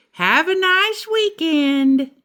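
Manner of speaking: teacher's goodbye. A narrator's reading. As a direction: send them off cheerfully like a teacher.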